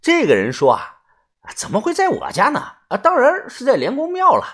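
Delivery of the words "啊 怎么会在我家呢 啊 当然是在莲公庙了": high-pitched protest; dismissive reply. A narrator's reading. Local tout correcting a doubtful customer, high-pitched and dismissive. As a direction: pitch it high, as if it were obvious. Stranger answers doubting questions.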